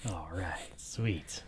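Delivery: quick whispered aside; hushed mock conclusion. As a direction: breathe it out.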